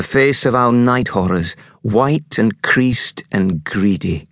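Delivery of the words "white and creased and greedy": ordinary delivery, nothing marked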